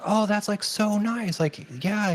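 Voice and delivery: very high squeaky mock praise; imitating Gimli actor. Fan mimics the actor praising her job in a very high squeal. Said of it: "And he's like".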